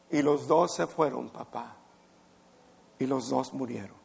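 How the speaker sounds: hushed grief. Real, not acted. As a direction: voice sinking almost to nothing. Climax of sad story.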